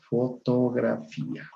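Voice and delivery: FOTOGRAFÍA slowly, syllable by syllable; full correct form. Shown as a demonstration. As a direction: lay out "fotografía" as fo-to-gra-FÍ-a. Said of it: "la palabra correcta es".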